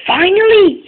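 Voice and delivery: a sudden high, delighted squeal; thrilled at last to have the car; excited. Acted. Engine Dodge spotting the new car, squealing with delight.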